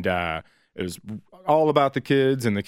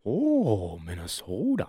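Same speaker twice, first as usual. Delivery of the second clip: mock Minnesota accent; 5 dB quieter, slower than usual. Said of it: "They go".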